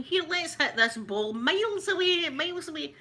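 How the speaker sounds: high-pitched mock drunk Scot; drunk Scottish guy. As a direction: play a boozy Scot hatching a plan. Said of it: "it'd be two drunk guys going".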